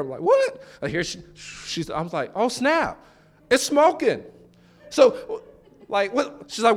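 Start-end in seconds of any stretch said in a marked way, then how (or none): 0.2-0.6 high-pitched, startled one-word question; startled reaction. Retold or imitated. Preacher replays his reaction to wife smelling smoke, startled. 2.4-6.4 higher-pitched comic realisation; mock alarm. Preacher retells spotting smoke from the car, mock alarm.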